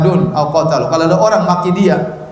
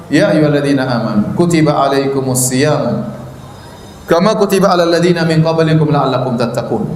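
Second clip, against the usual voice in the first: lantunan ayat; 4 semitones lower than usual. Acted. Membaca ayat Al-Quran.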